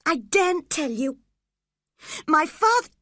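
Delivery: put-on high, frightened gasp of a boy. A narrator's reading. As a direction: gasp it out in fear. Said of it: "the boy gasped".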